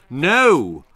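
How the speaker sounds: loud angry roar. Acted. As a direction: bellow it at her. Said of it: "roared the bogeyman".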